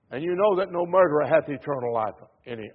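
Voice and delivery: ordinary delivery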